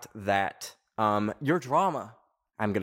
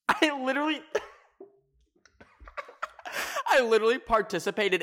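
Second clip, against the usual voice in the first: real high, choked-up squeak; laughing through tears; 12 semitones higher, faster than usual. Real, not acted. Confessing she helped kill the Minion, laughing through tears. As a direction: struggle to get words out, half laughing, half crying. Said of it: "Why am I crying?".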